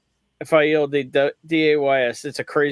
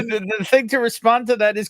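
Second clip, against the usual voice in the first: ordinary delivery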